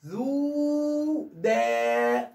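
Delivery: very slow ذو دا, each vowel held; both syllables lengthened. Shown as a demonstration. Teacher corrects to show two madds, ذو دا stretched on both. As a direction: draw out both the "oo" and the "aa" of ذو دا. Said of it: "عشان تطول دي وتطول دي".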